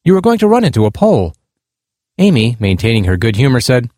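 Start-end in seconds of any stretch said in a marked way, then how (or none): 0.0-1.3 higher, mildly stressed warning; a touch of alarm in a raised pitch; lightly voiced stressed stranger. A narrator's reading. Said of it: "answered in a stressed tone".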